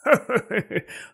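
high clucking cackle; clattering jaw cackling skull. Acted. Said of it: "cười khùng khục".

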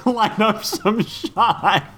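slow laughing sing-song. Acted. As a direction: laugh the line out slowly. Lyric laughed out slowly.

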